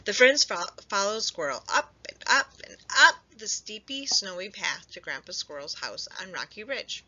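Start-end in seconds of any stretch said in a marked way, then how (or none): none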